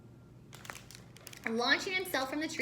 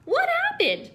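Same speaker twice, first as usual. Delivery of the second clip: rising to a high, loud astonished cry; amazed disbelief that builds to a shout; 6 semitones higher, 7 dB louder, slower than usual. Acted. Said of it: "Verdi was astonished".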